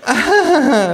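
very high, loud forced laugh; fake laugh. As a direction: laugh artificially, hostess style. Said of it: "lacht die Frau künstlich".

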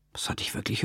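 fast, sheepish whisper; whispered aside. Acted. The narrator admits missing the contract clause, whispering fast. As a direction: whisper it quickly, caught out.

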